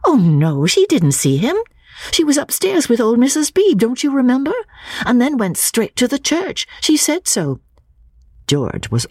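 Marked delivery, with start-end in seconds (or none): 0.1-7.6 put-on a young woman's voice pitched higher, earnest; Lucy pleading her cousin's case, raised higher; Lucy's voice. Lucy insisting to George, higher earnest voice.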